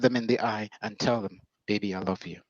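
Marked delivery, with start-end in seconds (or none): 1.7-2.4 put-on soft, quiet, tender "Baby, I love you"; sincere love words. Shown as a demonstration. Husband telling his wife he loves her, soft and sincere. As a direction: murmur it gently, meaning every word. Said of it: "And then say it like you mean it".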